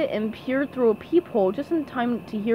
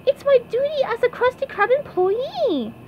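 very high, earnest cartoon voice; SpongeBob; 13 semitones higher than usual. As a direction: insist in a squeaky pipsqueak voice. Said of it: "said SpongeBob".

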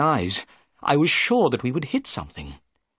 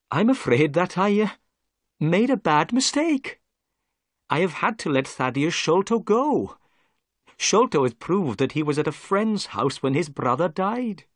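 lightly voiced police inspector, a little higher; a hint of a humbled inspector, polite; politely greeting the doctor, lightly voiced; Inspector Jones; 6 semitones higher than usual. A narrator's reading.

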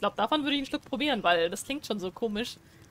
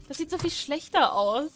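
ordinary delivery

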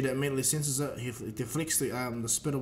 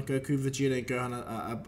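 plain reading voice

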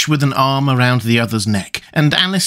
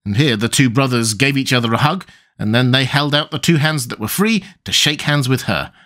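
ordinary delivery

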